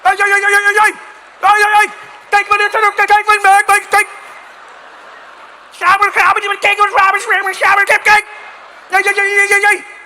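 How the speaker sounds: high, loud frantic yelling of gibberish; mock angry yelling. Comic imitating enraged Dutch cyclists, shouting. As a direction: shriek like a furious cyclist barreling down.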